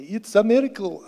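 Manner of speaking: loosely mimicked Zimbabwean accent. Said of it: "in her Zimbabwean accent say".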